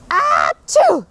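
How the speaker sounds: loud acted sneeze; big sneeze. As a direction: let a huge theatrical sneeze burst out. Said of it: "I sneezed a great sneeze".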